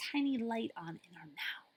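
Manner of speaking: hushed storybook whisper. Acted. Librarian whispering the image to children. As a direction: whisper it with wonder.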